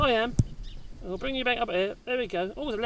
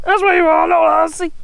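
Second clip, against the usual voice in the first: high, louder doggy voice with a different sound; pet talk; 8 semitones higher, 3 dB louder than usual. Pretend. Owner teasing his dog in high baby talk.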